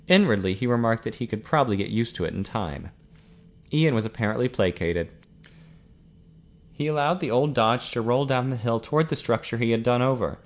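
plain reading voice

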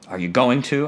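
ordinary delivery

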